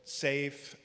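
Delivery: ordinary talk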